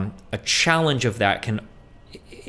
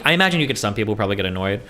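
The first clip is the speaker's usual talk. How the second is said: ordinary talk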